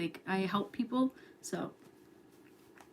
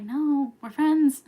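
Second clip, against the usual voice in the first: loud, high exclamation; hammed-up insistent rejection; mock protest; 6 semitones higher, 10 dB louder, much slower than usual. Streamer replaying turning down a crush, loudly protesting.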